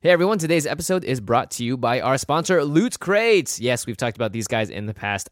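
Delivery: loud, upbeat sponsor pitch; ad read. Acted. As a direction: sell it like a podcast host.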